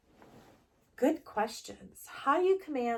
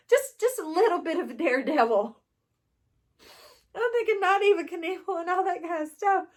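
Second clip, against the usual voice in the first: excited; 8 semitones higher, 10 dB louder than usual. Real, not acted. Excited outburst over daredevil card.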